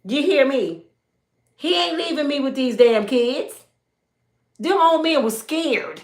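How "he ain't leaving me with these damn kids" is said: ordinary delivery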